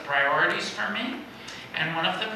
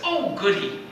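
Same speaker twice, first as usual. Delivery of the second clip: British inflection; 4 semitones higher than usual. Retold or imitated. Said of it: "speaks English with somewhat of a British inflection".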